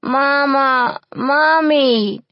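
high, loud sobbing scream; screaming for mama. A narrator's reading. Orphan girl screams for her mother in a nightmare, high sobbing scream. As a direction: scream for mama through tears. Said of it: "screamed Molly again".

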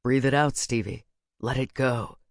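low, soothing character voice; calming. A narrator's reading. Talking someone down.

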